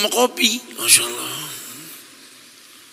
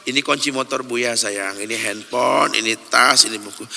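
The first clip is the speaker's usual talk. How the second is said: put-on soft, lower, tender reply to a child; gentle answer; 8 semitones lower, 5 dB quieter than usual. Father gently answers his curious small child, soft and tender. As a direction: answer the little one softly and patiently.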